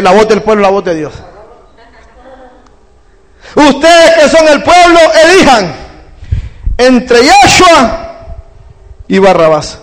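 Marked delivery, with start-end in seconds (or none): 3.5-9.8 lightly voiced Pilate. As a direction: put the choice to the people as the governor. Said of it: "y le dice".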